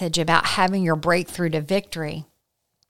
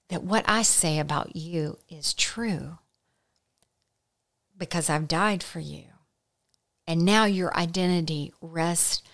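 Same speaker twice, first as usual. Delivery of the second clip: tender voice of Jesus; 4 dB quieter than usual. Acted. Jesus to the doubter, soft tender invitation. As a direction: speak it gently as a loving call. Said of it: "Jesus is saying".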